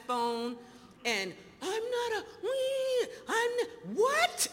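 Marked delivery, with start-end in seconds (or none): 1.6-4.5 much higher, indignant sputter; outraged. Retold or imitated. She sputters back at her sponsor, high and indignant. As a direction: splutter in shock.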